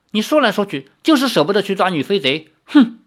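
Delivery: fast accusation ending in a loud, high huff; angry. Acted. Lin angrily accuses Li of sparing the thief, ending loud. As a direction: snap at him, then huff. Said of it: "林月如怒道".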